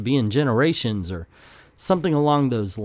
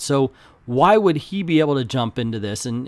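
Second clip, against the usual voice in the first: usual speaking voice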